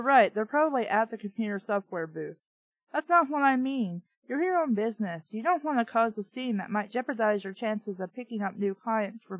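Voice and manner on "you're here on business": usual speaking voice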